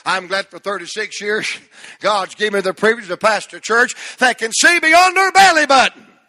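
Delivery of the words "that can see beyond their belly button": loud cry climbing on the punchline; preaching shout. Real, not acted. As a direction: shout the reworded punchline home. Let me do it like this.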